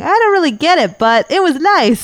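put-on high, drawn-out naive kid voice; mock clueless kid. Friend mocks the teen reviewer in a high, slow kid voice. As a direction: play the kid as sweetly clueless. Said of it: "if he's saying".

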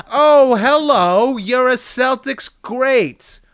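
fake-impressed greeting; mock awe. Roaster pretending to greet a Celtics great in mock awe.

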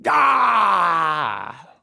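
loud hammed-up scream of anguish; mock scream. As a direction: let out a fake tortured yell.

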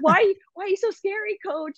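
put-on high kid voice, softening at the end; imitating the girls. Players quoted calling their coach scary, high kid voice. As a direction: play a startled young player. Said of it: "they're like".

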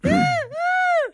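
shrill high gull screech; screechy seagull voice. Pretend. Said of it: "seagull impression, what would it be?".

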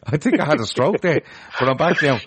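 laughing through the words. Real, not acted. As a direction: chuckle it out, amused at himself. Laughing at his own slip.

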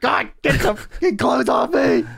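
high shouted mock panic; hammed-up itchy frustration; mock frantic shout. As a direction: yell it like the clothes are burning. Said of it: "you're like".

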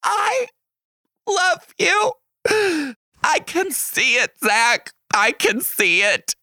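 put-on high, tearful kid voice gushing love; a child's teary, shouted thanks. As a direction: squeal it like an overjoyed little kid. Kid gushing over birthday card.

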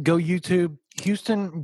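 usual speaking voice

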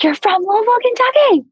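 high, loud, put-on mother voice insisting; imitating her mother. Mother scolding daughter about her hometown, high indignant mock voice. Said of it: "she's like".

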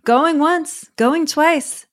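high, brisk auctioneer call; mock gavel call, going once. Mock auction handing over to Felix.

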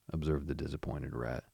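ordinary delivery

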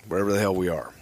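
ordinary delivery

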